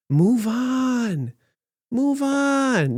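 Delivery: dismissive mock chant of "move on"; brushing off a scandal in a high mocking voice; sarcastic. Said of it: "I'm being sarcastic here again".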